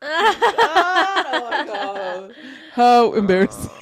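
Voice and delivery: slow laughing oh my God, then loud; laughing embarrassment. Retold or imitated. Retelling her embarrassing messages to a friend, laughing.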